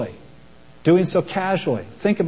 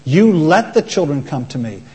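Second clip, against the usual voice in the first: ordinary talk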